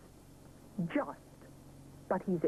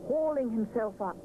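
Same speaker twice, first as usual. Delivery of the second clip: plain reading voice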